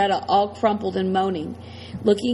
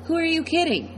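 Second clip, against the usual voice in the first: higher-pitched incredulous question; scoffing disbelief; 7 semitones higher than usual. A narrator's reading. Killer dismissing the squirt gun, scoffing. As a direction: scoff at an empty threat. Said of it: "shakes his head like".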